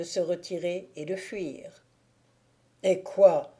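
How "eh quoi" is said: a sharp, louder cry of dismay; exclaiming. Acted. Thibaut cries out to the frightened Agnolette, louder. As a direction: call out to her in alarm. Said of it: "s'écria le meneur de loups".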